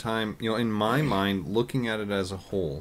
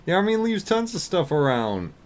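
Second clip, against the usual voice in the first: much higher, louder breezy quip; Hawkeye voice; 14 semitones higher, 6 dB louder than usual. Retold or imitated. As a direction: toss it off like a carefree surgeon. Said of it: "Hawkeye said".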